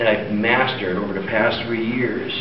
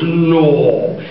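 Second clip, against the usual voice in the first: loud, drawn-out Irish disbelief; mock-amazed Irish brogue; drunk Irishman; 3 semitones higher, 6 dB louder than usual. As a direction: balk like a tipsy Irishman, loudly. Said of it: "the Murphy twins are drunk again".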